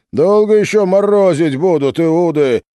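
high, loud angry yell; angry shout. Acted. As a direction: yell at the closed gate. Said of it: "гудело в толпе".

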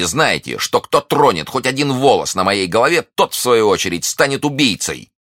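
very high, loud threatening cry; furious threat. A narrator's reading. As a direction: warn them with rising fury. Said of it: "воскликнула миледи". (яростная угроза, высоко и громко)

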